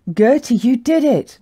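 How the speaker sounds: high, loud cheer. Acted. Herd greeting Gertie's return, loud cheering. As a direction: call out in acted triumph. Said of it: "cheering loudly".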